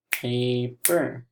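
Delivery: PAPER in two separate beats, pa, per; split into syllables. Shown as a demonstration. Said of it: "we can snap that first word".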